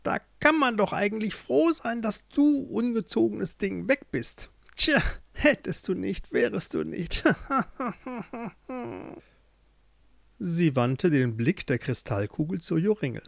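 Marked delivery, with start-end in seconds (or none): 0.0-7.2 higher-pitched witch, scornful and smug; gloating witch. Acted. 7.3-9.2 high, soft cackle trailing down; witch's gloating chuckle. Acted. Witch gloating over her captive.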